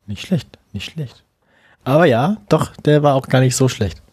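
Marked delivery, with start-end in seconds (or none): 0.1-1.2 quiet. Real, not acted. Lukewarm approval.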